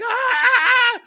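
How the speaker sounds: high, loud yelp of pain; pain yell. Retold or imitated. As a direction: cry out as if stabbed. Getting stabbed in dream.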